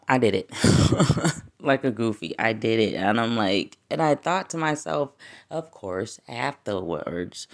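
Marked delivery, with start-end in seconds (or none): none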